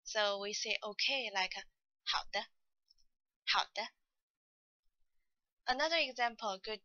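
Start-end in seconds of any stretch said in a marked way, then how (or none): 2.0-3.9 好的 with a short falling 好; half third tone. Shown as a demonstration. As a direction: say 好的 letting 好 fall only, then a light 的. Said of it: "好 is only read half third tone".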